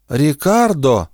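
drawn-out call down the hall. A narrator's reading. Grandmother calls her grandson from the hallway, drawn-out. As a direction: call the name slowly from afar. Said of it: "донёсся из коридора голос Паломы".

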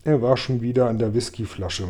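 ordinary delivery

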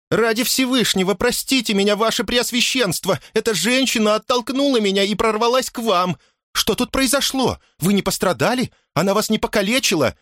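very high, frantic housekeeper; agitated high-voiced fussing; breathless alarm. A narrator's reading. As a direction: pour out apologies and worried questions, pitched far up. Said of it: "Домоправительница задыхалась от бега".

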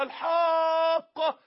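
long, high, loud wail of الحاقه; drawn-out cry. Shown as a demonstration. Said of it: "ده واحد بيجري بيصرخ في الطريق".

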